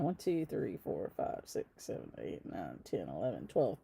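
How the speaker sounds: very soft, low mumbled count; counting under breath. Real, not acted. Host making sure she got everything, counting under her breath. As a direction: count to yourself, barely voiced.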